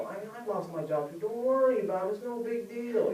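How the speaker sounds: high, light, brushing-it-off tone; mock-cheery "no biggie" reassurance; breezy dismissal. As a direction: wave it away in a high singsong. Downplaying her job loss to her.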